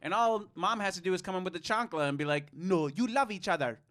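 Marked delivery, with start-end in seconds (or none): 2.5-3.8 mock Jamaican-Irish mom's accent; lightly put-on Jamaican-Irish mom voice; odd mock accent. Mom with the chancla, in a mock Jamaican-Irish accent. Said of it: "I don't know what accent that was".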